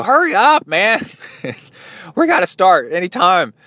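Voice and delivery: high, loud mock yell to hurry up. Impatient racer yelling at the porta can, high mock holler. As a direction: pound on the door and holler, half joking. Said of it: "bang on the doors of the porta can and go".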